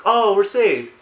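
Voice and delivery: high, loud mock relief; hopeful villagers. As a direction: cry out as naive rescued townsfolk. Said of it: "with hope in their eyes".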